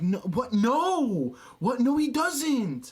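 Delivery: high, incredulous no; high, stretched out outraged protest; shocked disbelief. Retold or imitated. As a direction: pitch jumps up in outraged disbelief. Shocked denial of friend's claim.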